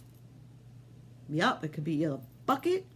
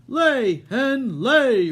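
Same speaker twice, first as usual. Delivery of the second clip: higher, much louder roar; giant roaring; 7 semitones higher, 11 dB louder, much slower than usual. Acted. Giant ordering his hen to lay, roaring. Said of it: "roared the giant".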